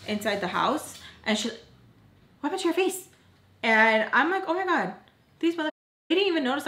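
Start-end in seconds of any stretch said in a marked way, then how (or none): 2.4-3.1 high-pitched imitation of her; high mock quote. Narrator imitating stepmother's high surprised question. Voldemort asking about face. 4.5-6.7 high, startled disbelief; surprised. Retold or imitated. Narrator recalling shock that Voldemort noticed her face.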